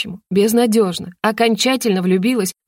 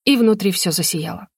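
usual speaking voice

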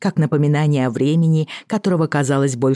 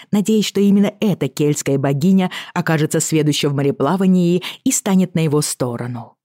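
usual speaking voice

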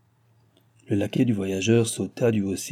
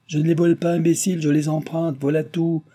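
higher-pitched impatient comeback; irritated retort; 6 semitones higher than usual. Acted. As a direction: snap back at the accusation. Vicomte retorts to the accusation.

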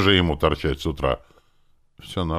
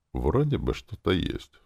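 ordinary delivery

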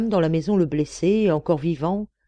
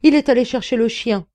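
louder, higher exclamation; alarmed cry; 5 semitones higher, 5 dB louder than usual. A narrator's reading. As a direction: cry out a warning. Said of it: "s'écria Miss Hunter".

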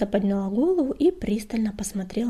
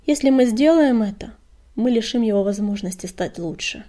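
usual speaking voice

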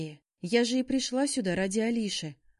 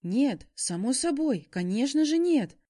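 alarmed backtracking; 6 semitones higher, 6 dB louder than usual. A narrator's reading. Startled boyfriend hastily backtracks.

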